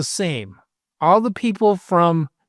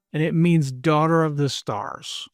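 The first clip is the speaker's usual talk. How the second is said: usual speaking voice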